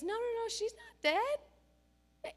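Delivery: slow, high, gentle reassurance; calm Jesus. Retold or imitated. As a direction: calmly contradict the mourners. And Jesus says.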